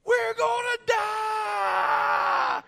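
very high, drawn-out screaming wail; child's scream. Retold or imitated. Little girl shrieking on the bumpy plane, long high wail. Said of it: "She screamed at the top of her lungs".

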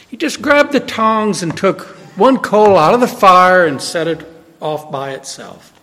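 usual speaking voice

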